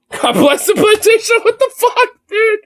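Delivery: very high, squeaky laughing exclamation; helpless laughter. Real, not acted. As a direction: wheeze it out while cracking up. Cracking up over the plantation character.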